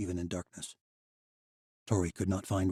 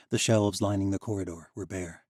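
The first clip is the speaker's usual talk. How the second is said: ordinary delivery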